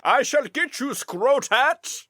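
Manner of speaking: high theatrical movie villain voice; booming echoing villain monologue. Pretend. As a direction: ham it up as a cartoon villain. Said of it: "sounds like a villain in a movie".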